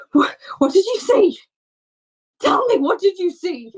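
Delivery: high, breathless panicked demand; panting, desperate. Acted. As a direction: gasp for air, then beg for an answer. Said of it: "panting, who's beating the man".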